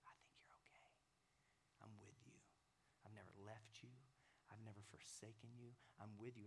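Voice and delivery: soft, breathy reassurance that fades up; gentle whisper. Acted. God reassures the lonely listener, whispering. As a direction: whisper it like a comfort. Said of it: "he's just gonna whisper".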